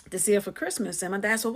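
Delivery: ordinary talk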